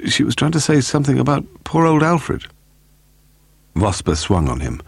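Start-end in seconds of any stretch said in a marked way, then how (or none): 0.1-2.5 high piped remark; piping. A narrator's reading. Scott Reed offers his theory about Alfred, piping. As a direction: pipe up well above usual pitch. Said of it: "Scott Reed piped surprisingly".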